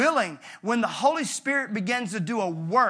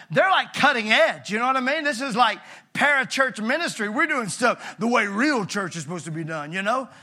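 ordinary talk, nothing marked

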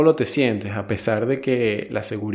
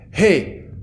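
put-on loud, higher English with a heavy foreign accent; yelling climbing instructor, thick foreign accent; instructor's foreign accent; 3 semitones higher, 7 dB louder than usual. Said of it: "en un acento un poco".

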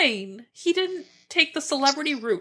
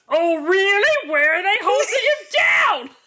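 incredulous movie quote; 8 semitones higher than usual. Retold or imitated. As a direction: shout it in shocked disbelief. Quoting movie scene.

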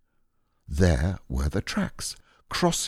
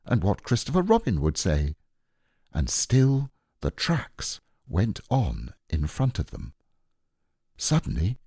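ordinary delivery

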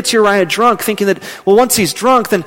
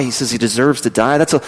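normal talking voice

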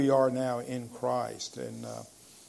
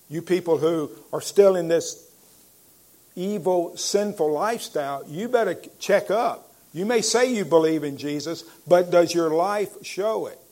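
louder, higher preacher's warning; preaching at full force; 3 semitones higher, 5 dB louder than usual. Acted. Preacher warns the congregation at full force. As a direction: call the sinners to check up.

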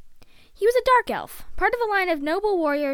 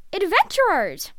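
louder, higher burst of excitement; excited realisation; 5 semitones higher, 6 dB louder than usual. A narrator's reading. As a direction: exclaim it as it dawns on you.

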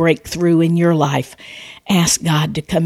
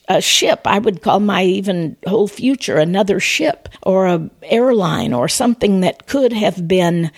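ordinary delivery

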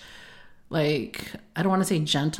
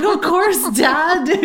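higher and louder laughing reply; 12 semitones higher, 7 dB louder, much slower than usual. Retold or imitated. Daughter laughing while retelling her answer to dad, higher and louder. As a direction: laugh through the words.

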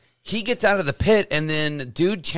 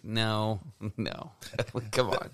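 low, very soft protest; hushed, half-amused disbelief; reluctant reaction; 6 semitones lower, 11 dB quieter, much slower than usual. Retold or imitated. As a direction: object quietly, half to yourself. Retells failing to let it go.

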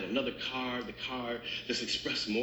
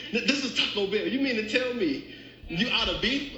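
acted-out outrage, higher and insistent; indignant disbelief; 8 semitones higher, 3 dB louder than usual. Hungry customer confronts the order taker, indignant disbelief. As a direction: protest like a cheated customer, pitched up.

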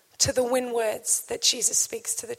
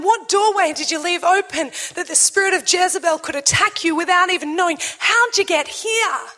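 louder, pressing questions; fervent preaching; 3 semitones higher, 3 dB louder than usual. Acted. Preacher pressing the church with God's questions, fervent. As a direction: drive each question home.

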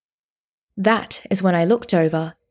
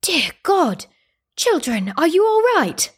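put-on alarmed old man; 10 semitones higher than usual. A narrator's reading. Old man finds the children.

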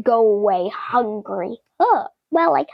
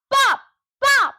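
popping sounds; 11 semitones higher than usual. Acted. Balloons popping.

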